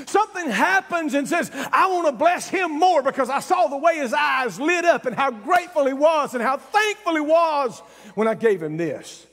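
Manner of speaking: ordinary delivery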